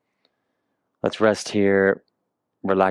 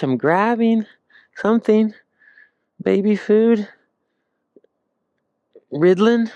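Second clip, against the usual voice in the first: put-on baby voice; 12 semitones higher, 3 dB louder than usual. Playing a baby reaching for things.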